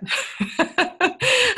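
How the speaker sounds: high-pitched burst of laughter; real amused laugh. Real, not acted. Laughing at her own joke.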